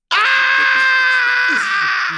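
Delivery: high screaming cackle; loud shrieking laugh. Real, not acted. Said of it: "Not you laughing at your own joke".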